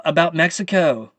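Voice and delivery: ordinary talk, nothing marked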